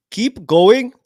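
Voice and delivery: high, loud, hammy attempt at an Indian accent; a botched Indian accent, pushed up high; failed Indian accent. Mocking Kamala's parents in a failed Indian accent. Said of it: "I can't do an Indian accent".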